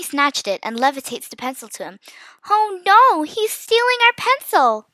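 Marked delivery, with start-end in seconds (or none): 2.5-4.9 high, loud, drawn-out cry; alarmed shout. A narrator's reading. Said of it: "Mia shouted".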